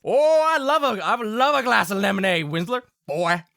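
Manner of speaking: loud, high country father, easing down; hearty Southern Pa. Acted. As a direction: relish it like a Southern pa. Game master plays Pa.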